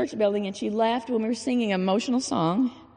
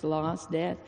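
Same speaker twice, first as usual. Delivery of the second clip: ordinary talk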